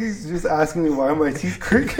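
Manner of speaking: real laugh breaking through, high and loud. Real, not acted. Telling friend about cousin's tooth question, laughing through the words. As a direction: tell it while cracking up.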